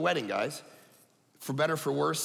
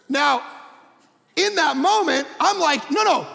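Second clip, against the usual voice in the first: emphatic preaching; 10 semitones higher, 6 dB louder than usual. Real, not acted. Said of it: "emphatic preaching".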